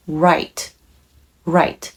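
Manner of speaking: 'right' starting from rounded lips, tongue touching nothing; low, separate 'right' with a rounded English R. Shown as a demonstration. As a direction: say 'right' with a slightly curled tongue, low pitch. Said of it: "Rを発音します".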